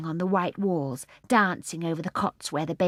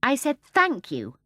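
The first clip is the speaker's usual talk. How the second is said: slow, higher repeat with a falling end; a little louder; 7 semitones higher, 4 dB louder, slower than usual. A narrator's reading. Mary Poppins rebukes the starling, a little louder and slower. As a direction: repeat it slowly, pointedly, more insistently. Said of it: "a little louder".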